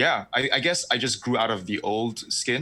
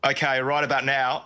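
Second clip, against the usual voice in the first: put-on Aussie accent; 3 semitones higher than usual. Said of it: "he's got the Aussie accent going".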